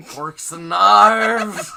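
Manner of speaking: slow, loud, laughing through it. Real, not acted. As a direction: crack up mid-phrase. Cracking up at own slip.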